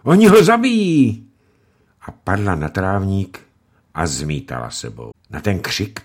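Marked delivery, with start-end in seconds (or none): 0.0-1.3 very high, loud shriek of terror; girl shrieking as her father is beaten. Acted. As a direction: shriek it out in panic. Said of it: "vykřikla".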